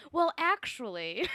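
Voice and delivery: mock know-it-all. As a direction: impersonate a pedantic corrector. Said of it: "doing a little bit of, like".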